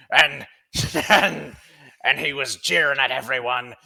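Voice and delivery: loud, high, laughing through the tale; laughing storyteller. Acted. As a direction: chuckle it out loud. GM voices laughing Turch telling story.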